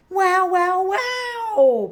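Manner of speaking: high, loud echoing calls. A narrator's reading. Peppa hears her echo from far off. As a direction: sound like a distant echo. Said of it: "Peppa hears in the distance".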